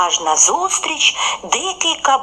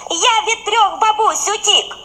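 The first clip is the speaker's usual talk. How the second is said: high, proud little boast; smiling bun; 10 semitones higher than usual. A narrator's reading. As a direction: brag with a smile, pitched up. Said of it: "усміхнувся рум'яний". (колобок хизується з усмішкою, високим голосом)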